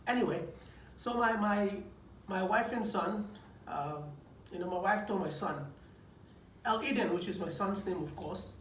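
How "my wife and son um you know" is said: ordinary talk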